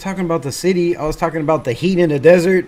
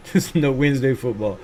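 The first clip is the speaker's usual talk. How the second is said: softer, laughing through it; chuckling repeat; 2 semitones lower, 4 dB quieter than usual. Shown as a demonstration. Streamer chuckling the line back to chat. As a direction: laugh it off as you say it.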